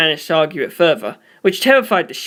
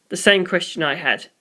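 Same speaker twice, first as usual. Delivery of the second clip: usual speaking voice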